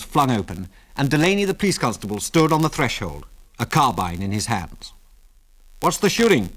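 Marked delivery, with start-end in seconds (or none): 5.8-6.5 blunt constable's question, pitched up; blunt inquiry. A narrator's reading. Constable bursts in on a bar gunfight, blunt. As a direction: demand it bluntly, raised voice pitch. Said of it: "he inquired bluntly".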